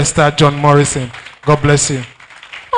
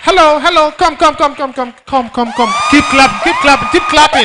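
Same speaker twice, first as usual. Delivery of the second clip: high shouted calls to clap; hyping the crowd; 10 semitones higher than usual. Real, not acted.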